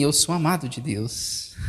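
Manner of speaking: hushed personal testimony; quiet sincere confession. Real, not acted. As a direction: let it land gently. Pastor's own quiet confession.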